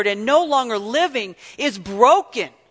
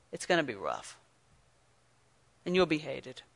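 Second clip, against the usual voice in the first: grave low quote; 8 semitones lower, 12 dB quieter than usual. Acted. Quoting Jesus gravely.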